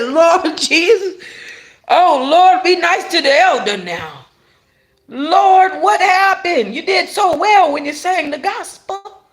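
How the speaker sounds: high, loud church-lady lament; mock pleading prayer. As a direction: appeal to heaven in comic dismay. Mock plea to the Lord over singer.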